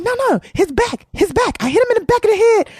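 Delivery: retold frantic insistence; insistent. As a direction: push back urgently, almost pleading. Insisting she hurt him.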